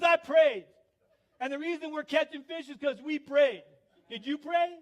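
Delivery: high, loud, self-righteous holler; loud, obnoxious buddy. Retold or imitated. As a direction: proclaim it smugly, then challenge them. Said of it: "loud, kind of obnoxious guy".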